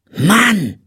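high, drawn-out exclamation of awe; impressed. A narrator's reading. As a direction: exclaim like an impressed little girl. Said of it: "Matschbacke war beeindruckt". (beeindruckter, hoher Ausruf)

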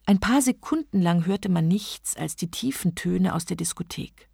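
plain reading voice

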